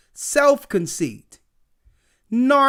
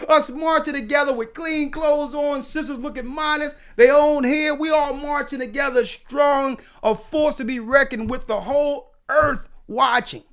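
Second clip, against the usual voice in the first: preaching; 5 semitones higher than usual. Acted. Preacher rising to fervor.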